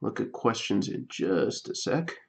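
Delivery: speaking softly, low and hushed; quiet. Real, not acted. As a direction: keep it soft while you focus. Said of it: "Getting quiet".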